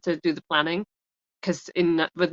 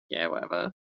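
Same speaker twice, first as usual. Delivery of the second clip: dismissive; 12 semitones lower, 9 dB quieter than usual. Retold or imitated. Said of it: "they're just like".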